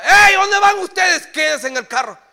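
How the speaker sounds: put-on dad roaring at runaway kids; father yelling. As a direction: bellow like a stern father catching kids. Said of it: "Y grita mi papá".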